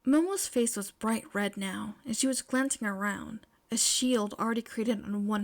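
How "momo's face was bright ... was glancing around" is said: usual speaking voice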